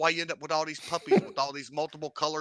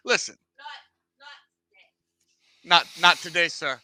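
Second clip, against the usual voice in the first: drawn-out mock refusal; 6 semitones higher, 8 dB louder than usual.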